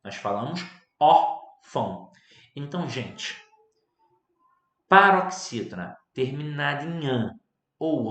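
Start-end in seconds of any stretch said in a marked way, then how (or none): 0.0-2.1 órfão said slowly with ÓR stressed. Shown as a demonstration. Teacher confirming to students the right órfão, slow and firm. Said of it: "e não é o caso".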